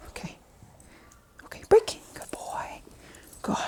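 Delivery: whispered release and praise; whispering. Real, not acted. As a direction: hush it, barely voiced. Releasing dog softly.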